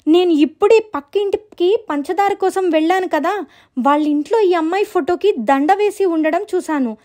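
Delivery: high, pleading little sister; acted flustered insistence. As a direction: plead to be believed. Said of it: "ఎందుకంత కంగారు పడుతున్నావ్".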